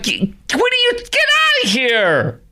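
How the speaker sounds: high, drawn-out exclamation of disbelief; slow right down on a high, exasperated dismissal; exasperated outburst. Acted. Host reacting to a complaining customer, exasperated disbelief.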